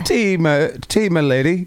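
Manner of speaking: high, put-on British servant; English accent. Shown as a demonstration. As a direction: offer it in a mock English accent. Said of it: "we'll do one right now".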